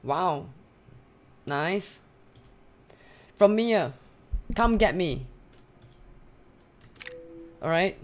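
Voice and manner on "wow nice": normal talking voice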